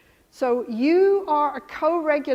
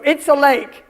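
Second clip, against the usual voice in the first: loud, blunt announcement; brusque wrong way; 9 dB louder than usual. Acted. Mother hurrying toddler at the lake, loud and blunt. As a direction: blurt it out loudly, without cushioning. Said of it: "You don't go".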